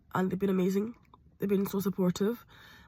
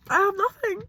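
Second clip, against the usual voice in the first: higher, more broken repeat; the second time, breaking higher; crying; 8 semitones higher than usual. Real, not acted.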